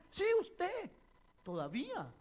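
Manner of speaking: sharp, loud, high mock accusation; mother's accusation. Mother insisting her son took the piggy bank, mock angry accusation. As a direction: snap back at the child as the accusing mother. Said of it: "mother accusing child".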